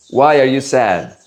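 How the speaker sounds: ordinary talk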